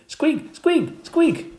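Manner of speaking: very high, loud hamster squeaks; squeaking. Acted. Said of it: "we kept up the noise".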